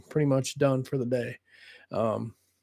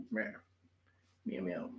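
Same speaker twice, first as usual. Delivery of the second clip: meowing at the cat; 5 semitones higher, 18 dB quieter than usual. Pretend.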